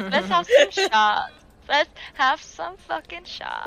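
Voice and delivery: put-on slow, loud, high drunken slur; drunk party girl. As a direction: drag it out like a wasted party girl. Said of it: "She's like".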